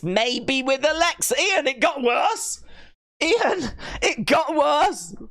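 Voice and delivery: high, slow appalled disbelief; mock horror. Streamer to Ian reading his comment, slow high mock horror. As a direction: drag it out, scandalised.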